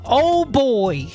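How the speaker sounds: ordinary talk, nothing marked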